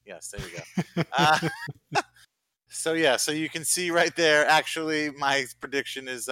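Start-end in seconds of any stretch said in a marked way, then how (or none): 0.1-2.0 laughing. Real, not acted. Still laughing at Thomas.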